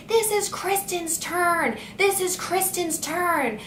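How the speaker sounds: high, slow whiny repeating; mock teacher scream. Teacher in the back yelling at the mother, high mock whine. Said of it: "keeps screaming".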